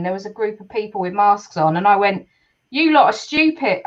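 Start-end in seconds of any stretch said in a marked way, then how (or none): none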